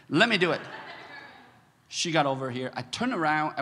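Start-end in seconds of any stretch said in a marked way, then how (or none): 0.1-0.6 raised, louder mock hero; heroic offer. Husband repeating his wife's offer, mock-heroic. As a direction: imitate a rescuer stepping in. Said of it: "Wow, how heroic".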